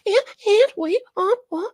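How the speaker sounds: put-on very high, halting little-kid voice; toddler playing prophecy. Host imitates a toddler grabbing for attention, squeaky toddler voice. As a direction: stumble along in a squeaky tot voice. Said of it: "This is like a toddler doing, playing prophecy".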